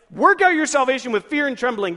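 urgent, mildly hammed-up dread; mock alarm. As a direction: fire the verse out as a scary demand. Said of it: "That sounds like I'm supposed to live every moment of my life scared".

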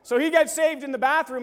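ordinary talk

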